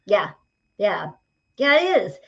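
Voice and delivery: ordinary talk, nothing marked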